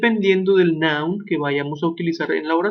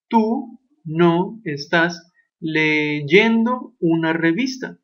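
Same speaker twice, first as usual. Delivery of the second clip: very slow word-by-word translation; much slower than usual. Shown as a demonstration. Teacher translating for the class, very slowly. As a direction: stretch each word out. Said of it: "You are not reading a magazine".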